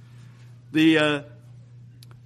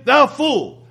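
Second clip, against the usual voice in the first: higher, louder sneering "Thou fool"; scornful insult; 6 semitones higher, 5 dB louder than usual. Shown as a demonstration. Preacher acting the insult to a brother, scornful. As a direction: spit "Thou fool" with contempt. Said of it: "Here we're saying".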